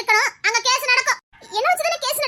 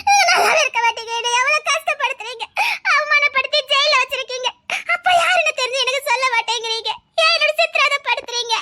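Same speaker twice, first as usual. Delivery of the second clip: tearful, sobbing reproach; crying. Acted. Girl blaming those who torment her, crying. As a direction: pour out grievances through tears. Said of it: "அழாத".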